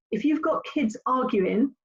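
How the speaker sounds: ordinary talk, nothing marked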